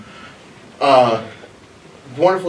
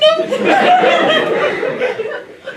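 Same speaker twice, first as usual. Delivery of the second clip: long high real laugh; 3 dB louder than usual. Real, not acted. Laughing at his own punchline. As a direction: break up at the punchline.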